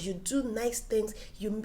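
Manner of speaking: normal talking voice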